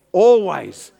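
ALWAYS called out loud, falling at the end; emphatic. Real, not acted. Preacher getting the congregation to repeat it, emphatic ALWAYS. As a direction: lead the room with a big, punched ALWAYS.

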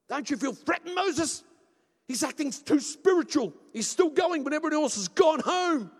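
high, shouted demands; alarmed Joshua. Acted. Joshua begs Moses to stop the man prophesying, shouting indignantly. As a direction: holler in mock outrage, urging action.

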